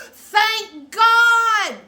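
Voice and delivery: high, very loud drawn-out cry of relief; imitating husband's holler. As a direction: holler it out to the heavens. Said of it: "he actually hollered it out".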